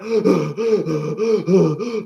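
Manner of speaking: long, repeated wheezing gasps; acted-out struggle for breath; mock gasping. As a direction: gasp for air like an asthma attack. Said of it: "And the guy's like".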